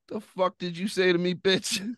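high-pitched, affronted comeback; indignant snapback. Retold or imitated. Drunk man snapping back at the magistrate, indignant. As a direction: fire back in high, retold outrage.

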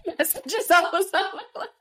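cracking up while naming her crush; laughing. Real, not acted. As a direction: giggle it out as you confirm.

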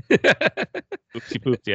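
slow, silly singsong; drawn-out teasing oopsie; playful baby talk. Acted. Playful admission of forgetting.

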